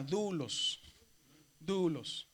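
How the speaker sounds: "dulos" con la U larga que sube y baja; vocal alargada. Shown as a demonstration. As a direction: estira la U de "dulos" y deja caer LOS. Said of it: "se alarga un poco y sube y baja". ("dulos" lento, con arco de tono en la U)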